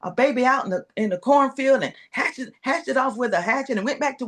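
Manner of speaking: a hint of an excited father telling tales; dad's voice. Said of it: "And he goes".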